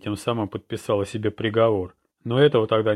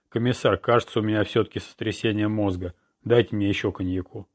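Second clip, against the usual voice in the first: plain reading voice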